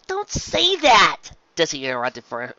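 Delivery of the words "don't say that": sharp, loud high cry; fierce. A narrator's reading. Mother stopping her daughter's words, fierce and loud. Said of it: "interrupted fiercely".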